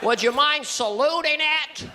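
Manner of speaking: high, drawn-out folksy character voice; halting and mild, swooping up on key words; Mister Stewart. Acted. Awkward man to his date, high halting drawl. Comic plays Stewart figure, named later.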